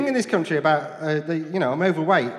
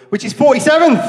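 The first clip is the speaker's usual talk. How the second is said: much higher, louder proud outburst; mock triumphant shout; 10 semitones higher, 11 dB louder than usual. Comic boasting to the crowd about his accent's ranking, loud and triumphant. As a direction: crow it like a big win.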